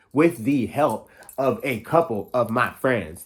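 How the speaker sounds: slow, deliberate, every word clear; slow and careful. Shown as a demonstration. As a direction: spell it out slowly on purpose. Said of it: "I speak so damn fast. I gotta slow down".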